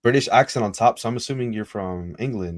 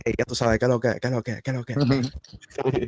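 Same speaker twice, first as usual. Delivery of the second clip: mock Dominican hype; 4 semitones higher than usual. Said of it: "he's probably in the beat like".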